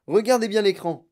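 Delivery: loud, high command; excited shout. A narrator's reading. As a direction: call everyone's attention urgently. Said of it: "cria-t-il".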